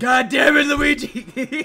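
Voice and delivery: loud high shout; mock yell. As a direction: bellow the name in fake outrage. Yelling at partner in game.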